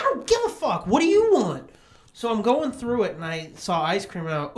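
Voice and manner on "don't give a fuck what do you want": high, snappy imitation of mom; mocking mom. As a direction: toss it off in a high put-on mom voice. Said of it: "And it's like".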